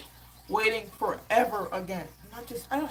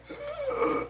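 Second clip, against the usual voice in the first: laboured breathing demo. Shown as a demonstration. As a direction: act out struggling to breathe. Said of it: "If I'm breathing like this".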